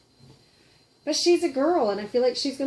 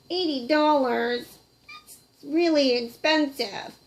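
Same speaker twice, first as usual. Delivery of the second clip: puppet voice; 5 semitones higher, 3 dB louder, slower than usual. Pretend. Puppet reacts to price.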